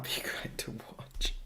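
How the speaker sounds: slow laughing whisper. Shown as a demonstration. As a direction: breathe it out with a giggle. Repeats line, second time whispered.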